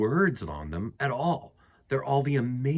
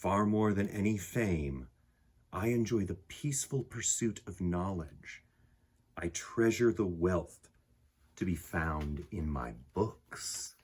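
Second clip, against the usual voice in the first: calm, softer read-aloud voice with unhurried pauses; gentle poem reading; 4 semitones lower, 4 dB quieter than usual. A narrator's reading. Reading the picture book poem to listening children, calm and soft. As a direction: read it like a quiet bedtime poem.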